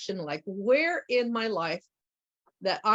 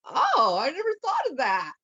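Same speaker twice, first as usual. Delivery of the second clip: surprised realisation; 6 semitones higher, 3 dB louder than usual. Retold or imitated. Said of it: "And he says".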